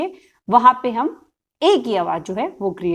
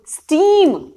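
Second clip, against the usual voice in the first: "Steam" said quick, higher and louder; clear loud word; 6 semitones higher, 4 dB louder, much slower than usual. Shown as a demonstration. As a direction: snap out "steam" firmly as the answer. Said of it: "जोर से बच्चे को पढ़ानी है". ("Steam" ऊँची और ज़ोरदार आवाज़ में)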